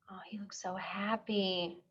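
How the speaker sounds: soft hushed whisper. Real, not acted. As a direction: breathe it out in a hush. Teacher whispers over the picture.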